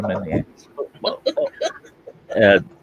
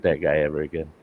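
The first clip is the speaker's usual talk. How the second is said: ordinary delivery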